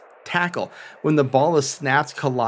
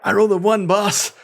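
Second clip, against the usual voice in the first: much higher, louder, a touch of panic; dismayed Callias; 6 semitones higher, 3 dB louder than usual. Acted. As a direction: blurt out the bad roll to the boss. Callias reports a failed roll.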